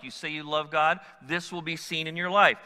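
ordinary delivery

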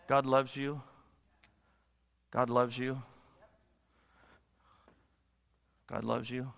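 low, softening, with long pauses; tender reassurance. Real, not acted. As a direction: repeat it gently and let each one land. Preacher tenderly repeats love to congregation.

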